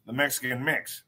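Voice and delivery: put-on low, soft old-timey announcer; lightly voiced forties radio man plugging the book; 1940s announcer voice. Plugging his mama's book, in a soft old-timey announcer voice. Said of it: "Why do I keep doing that voice?".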